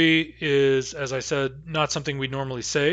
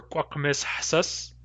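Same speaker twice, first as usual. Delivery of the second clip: slow demo pronunciation; 4 dB quieter, slower than usual. Shown as a demonstration. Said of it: "the better way to say it".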